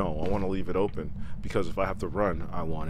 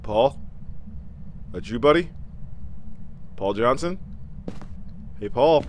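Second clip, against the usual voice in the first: loud, much higher calls; calling out; 6 semitones higher, 6 dB louder than usual. Real, not acted. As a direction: call into the dark house. Calling ghost loudly.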